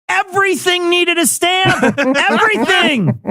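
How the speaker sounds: high, drawn-out exaggerated emphasis; dramatic. Real, not acted. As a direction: overdramatize the word Everything. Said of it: "maybe I'm being dramatic here".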